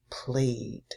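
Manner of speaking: Played with a soft, voiced d at the end; Played said low and gently, ending on a clear d. Shown as a demonstration. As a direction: let the d in Played be heard, said quietly. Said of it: "makes the D sound".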